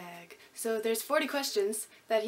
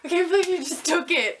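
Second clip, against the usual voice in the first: normal talking voice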